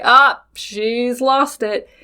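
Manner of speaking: slow, dismissive sigh; mock skeptic. Podcast listener doubting her story, lightly mocking.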